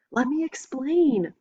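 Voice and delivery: pleading shout, pitched high and slow. A narrator's reading. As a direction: call out over her screaming. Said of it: "I shout".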